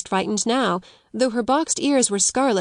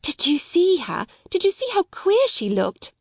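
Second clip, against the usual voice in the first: much higher, eager gossiping rush; bursting to share the news; breaking out; 10 semitones higher than usual. Acted. Schoolgirl to her friend, excited high gossip. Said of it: "Jessie broke out".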